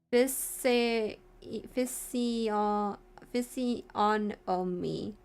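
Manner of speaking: physiognomy dragged out very slowly; frustrated syllable-by-syllable reading; sounding out a word. Real, not acted. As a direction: stumble on physio- then crawl through physiognomy. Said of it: "Stupid ass word".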